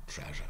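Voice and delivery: TREASURE as "TREZH-uh", softly; modern English. Shown as a demonstration. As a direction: say Treasure quietly with "zh". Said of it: "modern English".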